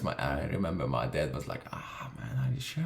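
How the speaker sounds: ordinary talk, nothing marked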